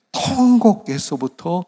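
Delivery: a slow, full-force pulpit shout; preaching cry. Real, not acted. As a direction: cry it out to the congregation. Preacher erupts at wailing.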